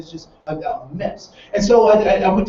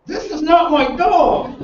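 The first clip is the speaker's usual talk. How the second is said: higher, slow stunned protest; disbelief; 7 semitones higher, slower than usual. Retold or imitated. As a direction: sound amazed it's not your dog. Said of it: "And so I'm like".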